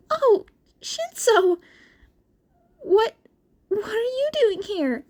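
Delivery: put-on high, light young-boy voice, hushed; nervous squeaky teen caught off guard; whispered Izuku. A narrator's reading. Izuku startled to see Shinso in library, high nervous voice. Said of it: "he whispered".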